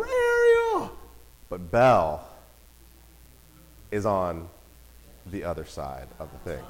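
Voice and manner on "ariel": put-on very high, thin child's call; little girl calling. Daughter imitated calling to Rapunzel, very high call. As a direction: call out like a little girl spotting her princess.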